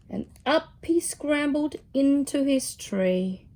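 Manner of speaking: plain reading voice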